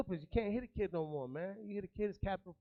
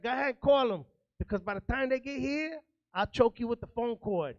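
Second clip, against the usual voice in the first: louder, higher mock threat from mom; threatening mom; 6 semitones higher, 7 dB louder than usual. Mother dares her son to call the cops, loud mock threat. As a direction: dare him to call, loud and high. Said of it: "She's like".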